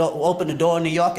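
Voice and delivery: usual speaking voice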